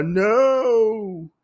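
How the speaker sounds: long, drawn-out high wail; mock cry of "no". Oda as Lady Asano attacks, imitated drawn-out high cry. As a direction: stretch one high cry, play-acted. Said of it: "And we hear a".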